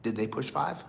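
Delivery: hushed, worried inner question; anxious thought. Retold or imitated. Listening on the collect call, a hushed worried thought. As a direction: wonder quietly if the line went dead. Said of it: "And you're thinking".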